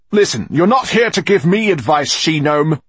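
loud, high angry snap; snapping. A narrator's reading. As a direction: bark it at her. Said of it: "he snapped at the moss woman".

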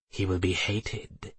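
slow, hushed creature voice; soft-spoken familiar. A narrator's reading. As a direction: warn her softly, drawing the words out. Familiar warns mistress in soft voice.

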